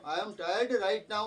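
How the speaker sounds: very slow, even; slow dictation. Retold or imitated. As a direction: read slowly to students. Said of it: "वैसे बोला कि".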